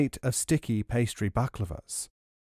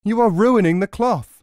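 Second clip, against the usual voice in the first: angry cry; 8 semitones higher, 8 dB louder than usual. A narrator's reading. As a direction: cry out in alarm at the crone. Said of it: "he cried".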